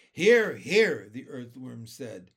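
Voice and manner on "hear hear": very loud, high exclamation; hearty agreement. A narrator's reading. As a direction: cheer agreement like a heckler. Earthworm cheers in agreement.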